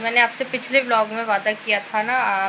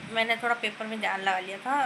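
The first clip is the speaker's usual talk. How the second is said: ordinary talk, nothing marked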